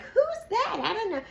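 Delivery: high, playing dumb; mock innocent. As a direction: act falsely clueless. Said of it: "they're like".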